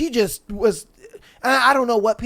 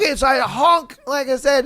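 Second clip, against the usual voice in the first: ordinary talk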